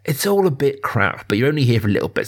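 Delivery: normal talking voice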